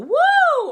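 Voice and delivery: high, loud, hooty "woo"; classical head-voice woo. Shown as a demonstration. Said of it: "so that's classical".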